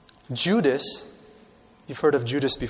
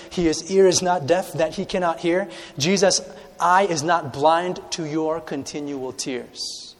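rising, fervent sermon over music; impassioned preaching; 4 semitones higher than usual. Acted. Preacher assuring the congregation, fervent. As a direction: preach it with building fervor.

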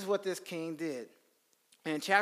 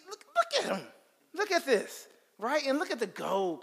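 much higher, eager look at this; excited show-off; 8 semitones higher, 4 dB louder than usual. Retold or imitated. Saul showing Samuel the spoil, high and eager. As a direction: show off the loot like a greedy king.

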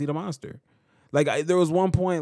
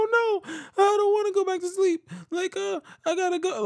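put-on very high childlike protest; imitating panicked son. Dad mimicking his son refusing sleep, very high and childlike. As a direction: squeak it out like a scared toddler. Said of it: "he freaks out".

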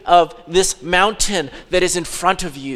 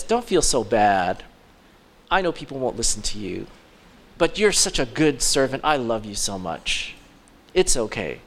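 low, soft coddling voice; mock-tender reassurance; mock comfort; 8 semitones lower, 5 dB quieter than usual. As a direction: soothe them like a pampered child, mockingly. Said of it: "we think of it in that way".

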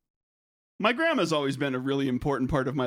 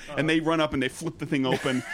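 ordinary talk

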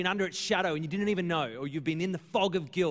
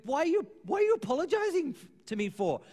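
incredulous question; 9 semitones higher than usual. Retold or imitated. Pastor's retold puzzled reply.

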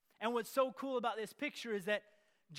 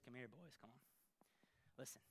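quiet call; 7 semitones lower, 20 dB quieter, faster than usual. Acted. John gathers his followers.

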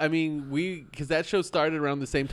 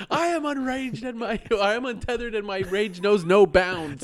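high, grandiose declaration; golden god quote; 7 semitones higher than usual. Retold or imitated. Reciting the golden god line to a friend, grand and high. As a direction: ham it up like a raging god. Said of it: "The golden gods".

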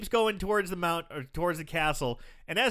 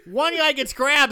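normal talking voice

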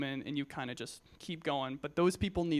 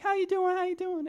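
much higher, louder put-on voice; homeless man's voice; 5 dB louder than usual. Youth speaker imitates homeless man's greeting, high put-on voice. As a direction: pitch way up like the homeless guy greeting you. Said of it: "Don't make fun of me for this voice".

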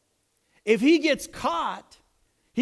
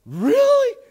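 very high, loud squeak of disbelief; hammed-up high, loud surprise; amazed; 10 dB louder than usual. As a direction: squeal it in astonishment. [name] amazed at being called back.

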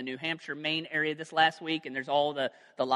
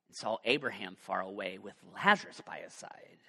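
lower, hushed whisper; 8 semitones lower, 5 dB quieter than usual. Acted. Preacher reading of the rich man seeing Abraham, whispered. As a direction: breathe it out like a secret.

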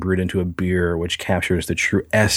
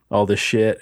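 ordinary talk, nothing marked

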